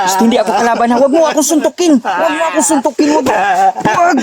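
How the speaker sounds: high-pitched, insistent pleading; urgent pleading. Acted. Man begs a struggling captive to stop hitting and listen, urgent pleading.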